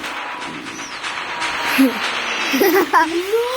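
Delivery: giggling laugh. Real, not acted. Laughs after prank voice.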